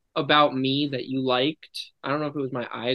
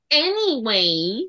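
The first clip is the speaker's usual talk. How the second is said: high, slow drawn-out cowgirl Anyway; cowgirl Mater voice; 7 semitones higher, slower than usual. Shown as a demonstration. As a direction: drawl it like a cartoon cowgirl. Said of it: "Here, let me try".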